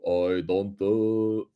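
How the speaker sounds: slow, drawn-out French giant voice; slow French-accented giant impression; Andre the Giant. Shown as a demonstration. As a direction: attempt a lumbering French giant, words stretched. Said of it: "Can we hear your slow French voice".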